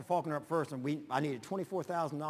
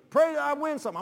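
higher, louder, jaunty call; loud cheerful quote; 11 semitones higher, 9 dB louder than usual. Retold or imitated. Gambler calling to the preacher, loud and jaunty. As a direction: toss it off as he strolls in.

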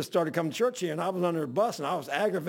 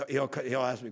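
ordinary talk, nothing marked

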